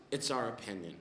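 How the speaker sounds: mild mock of a mom closing the subject; end-of-discussion. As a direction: shut the talk down. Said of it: "sounded like, "This conversation is over".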